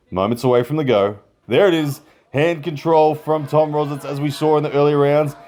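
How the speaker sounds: excited commentator calling the action; live play-by-play. Real, not acted.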